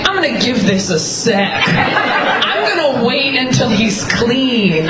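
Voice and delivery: slow, deliberate scheming; imitated calculating friend. Imitating friend who waits out cheater's shower, deliberate. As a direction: draw it out with deliberate patience.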